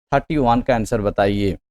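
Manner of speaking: ordinary talk, nothing marked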